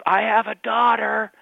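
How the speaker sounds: slow, high, loud tipsy announcement; imitating drawn-out drunken indignation; drunk lady. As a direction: proclaim it like a drunk woman. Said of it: "and she goes".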